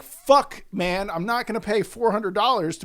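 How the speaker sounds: loud, high frustrated swear; exasperated outburst. Retold or imitated. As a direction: blurt it out in frustration. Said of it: "I was like".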